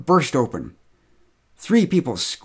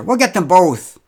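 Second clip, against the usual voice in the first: loud; 6 semitones higher, 7 dB louder than usual. A narrator's reading. Marcia brushing it off.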